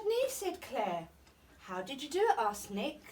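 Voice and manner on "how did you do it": ordinary delivery, nothing marked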